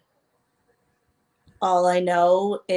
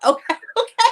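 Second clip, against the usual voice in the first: laughing it out, pitch jumping way up and louder; laughing okay; 6 dB louder, much faster than usual. Real, not acted. Host laughing at the guest's word, laughing.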